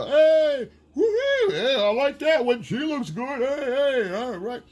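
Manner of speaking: high, loud hollering at a passerby; catcalling yell. Retold or imitated. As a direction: imitate a rowdy construction crew's catcalls. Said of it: "they yell at you".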